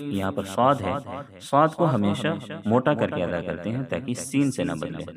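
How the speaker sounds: ordinary talk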